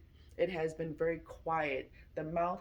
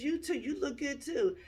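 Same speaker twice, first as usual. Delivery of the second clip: high voice for her; 8 semitones higher than usual. Retold or imitated. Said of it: "she says".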